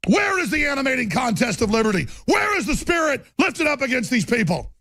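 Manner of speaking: real ranting shout, pitch way up; impassioned shout. Real, not acted. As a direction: preach it at full force, outraged. Impassioned rant peaks.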